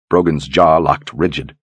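plain reading voice

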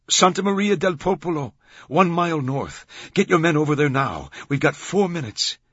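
high, loud rapid-fire orders; urgent command. A narrator's reading. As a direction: order them there before time runs out. Ordering men over, time running out.

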